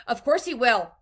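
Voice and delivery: shrieked. A narrator's reading. Mother argues back at Wonka, shrieked. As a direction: contradict him at full force. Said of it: "shrieked Missus Gloop".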